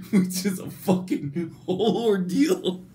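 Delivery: can barely get the words out for laughing; helpless laughter. Real, not acted. Telling viewers about the panda passenger, cracking up. Storyteller breaks into real laughter.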